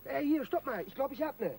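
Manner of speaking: sudden thrill of discovery; excited find. Acted. Digger finding the loot suitcase, excited.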